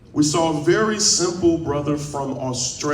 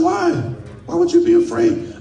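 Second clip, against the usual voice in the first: much higher-pitched, incredulous imitation; astonished "why" in a high retold voice; retold surprised question. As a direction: imitate a puzzled friend, voice leaping up.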